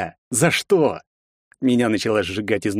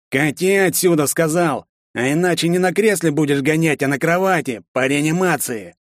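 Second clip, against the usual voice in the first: threatening thug; 6 semitones higher than usual. Acted.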